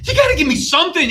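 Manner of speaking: ordinary talk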